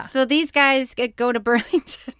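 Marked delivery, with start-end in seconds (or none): 1.1-2.1 real laugh on the place name; can't say it without laughing. Real, not acted. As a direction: laugh breaking into the phrase. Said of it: "can't say it without laughing".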